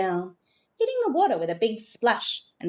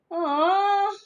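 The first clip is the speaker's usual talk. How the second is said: disappointed groan; 11 semitones higher, 4 dB louder than usual. A narrator's reading. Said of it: "the tourists groaned".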